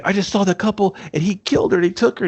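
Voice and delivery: high, frantic retelling of Eve; mock-panicked wife blurting it out. Eve panicking to Tom about the couple. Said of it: "And she's like".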